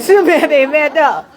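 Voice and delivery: high, loud words through laughter; laughing retelling. Real, not acted. Teller laughing while repeating mom's line. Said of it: "laughs She said".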